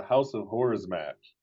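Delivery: Horrors said as a short "Hawrz" in a Northeastern accent. Shown as a demonstration. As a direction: House of "Hawrors" with a lazy Northeastern vowel. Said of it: "You have to do that Northeastern accent".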